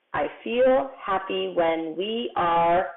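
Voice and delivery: very slow, word-by-word read; slow reading aloud. Shown as a demonstration. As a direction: read it back slowly, lingering on each word. Rereading the sentence word by word.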